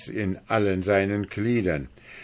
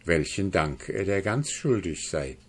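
usual speaking voice

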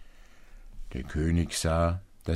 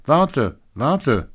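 loud, high frog call, each word falling; 8 semitones higher, 9 dB louder than usual. A narrator's reading. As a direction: shout after someone who is leaving. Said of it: "rief der Frosch". (lauter, hoher Ruf des Froschs)

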